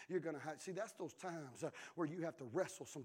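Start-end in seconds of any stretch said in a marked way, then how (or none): none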